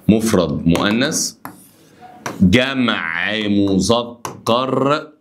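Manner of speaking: drawn out, each term held; teacher dictating terms; slow listing drill. Shown as a demonstration. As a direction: lay out each category slowly. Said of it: "teacher spells out categories".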